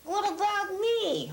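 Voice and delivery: the dummy wanting attention, high and drawn out. Acted. Dummy interrupts the ventriloquist.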